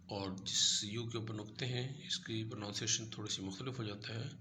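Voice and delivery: ordinary talk, nothing marked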